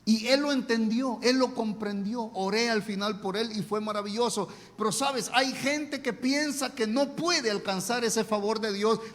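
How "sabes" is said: usual speaking voice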